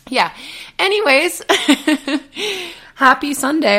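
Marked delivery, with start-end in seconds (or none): 1.5-2.7 high laugh burst; bright laugh. Real, not acted. Coach laughing before wishing happy Sunday, bright laugh. 2.9-3.8 cheery. Real, not acted. Cheery greeting.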